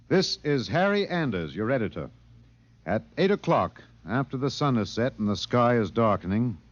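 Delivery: dramatic radio host narration; measured broadcast storyteller. A narrator's reading. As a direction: set the scene like an on-air editor. Narrator opens the show.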